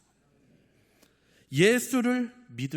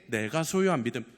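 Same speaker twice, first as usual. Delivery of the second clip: normal talking voice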